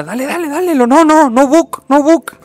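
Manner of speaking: loud, high calls to a driver, waving him in; shouted directions. Retold or imitated. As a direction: yell it across the parking lot. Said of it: "Y le decía".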